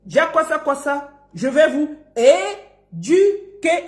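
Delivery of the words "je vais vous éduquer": very slow "é-du-quer" for "éduquer"; drawn-out rounded "du" in "éduquer"; syllable dictation. Shown as a demonstration. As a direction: teach "éduquer" one syllable at a time. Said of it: "il apprenne à dire ça".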